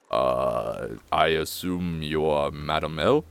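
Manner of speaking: low, drawn-out butler voice; disheveled butler. Acted. Butler greeting visitors at the door, low and measured. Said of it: "and he goes".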